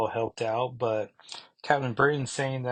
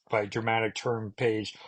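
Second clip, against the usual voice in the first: normal talking voice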